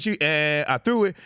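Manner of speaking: usual speaking voice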